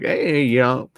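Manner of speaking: a deliberately bad Texan accent; mock Texan accent. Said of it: "Texan stuff".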